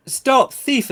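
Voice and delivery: loud high call after a thief; shouting stop thief. Acted. Player character shouting after the fleeing thief, loud. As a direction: yell it down the alley to stop her. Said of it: "I'm gonna shout".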